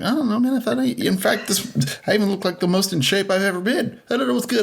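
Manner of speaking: high-pitched, bewildered self-defence; mock-defensive protest. Acts out own defensive reply to friend.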